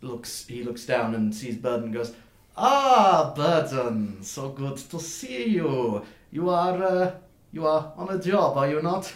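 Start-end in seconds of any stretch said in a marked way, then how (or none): 2.6-9.1 high, loud gushing greeting; effusive dwarf merchant. Acted. Thin-bearded dwarf sees Burden from his cart, high effusive greeting. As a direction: greet an old acquaintance with showy delight.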